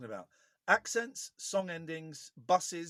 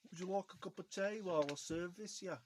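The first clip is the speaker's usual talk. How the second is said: put-on Brummie, softer; Brummie accent; 3 semitones higher, 5 dB quieter than usual. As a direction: play a Brummie, lightly and softly. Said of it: "Have you got a Brummie?".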